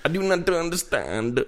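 high, slow mock foreign accent; exaggerated halting foreign-accented English; put-on foreign accent. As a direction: drag it out in a comic foreign accent. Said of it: "it was just like that".

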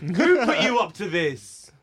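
slow, deliberate interrogation; slow stern demand. Acted. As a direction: drag it out like a detective. Repeated slow accusation.

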